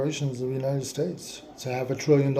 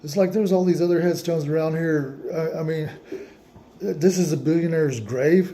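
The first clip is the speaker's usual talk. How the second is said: ordinary talk, nothing marked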